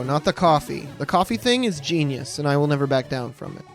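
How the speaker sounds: ordinary talk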